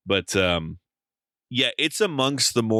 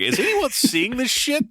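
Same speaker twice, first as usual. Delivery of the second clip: much higher, stunned disbelief. Retold or imitated. Recalling the arena moment for friends, high incredulous question. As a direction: ask it as if you can't believe your eyes. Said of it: "I was like".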